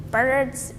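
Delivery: plain reading voice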